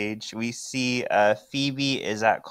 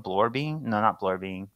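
ordinary talk, nothing marked

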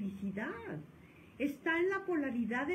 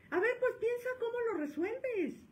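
much higher mock boss voice; bossy supervisor; 12 semitones higher than usual. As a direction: mimic a boss ordering a worker. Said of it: "que le dicen al trabajador". (jefe que le ordena al trabajador, voz aguda de burla)

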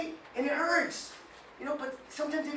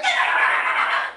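mock startled gasp; 9 dB louder than usual. People startled by what you say.

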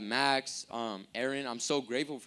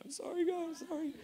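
very high, soft imitated tearful plea; mock bawling; 5 dB quieter than usual. Imitating a penitent at the altar, high mock bawling. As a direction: imitate someone crying at the altar. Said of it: "bawling their eyes out".

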